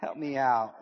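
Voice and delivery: usual speaking voice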